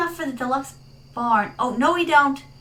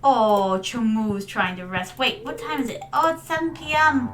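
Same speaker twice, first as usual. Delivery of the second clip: ordinary talk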